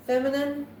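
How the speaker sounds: ordinary talk, nothing marked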